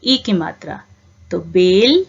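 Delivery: normal talking voice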